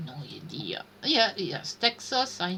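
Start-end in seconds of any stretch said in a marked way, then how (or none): 0.1-0.8 breathy whisper to herself; whispered aside. Real, not acted. Stuck on the map quiz, whispering she has no idea. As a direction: admit it under her breath, no voice.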